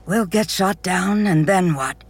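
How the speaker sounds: a hint of nerves in Quell; worried Quell. Quell to Tenzin on the tram, mildly anxious. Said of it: "Quell asked".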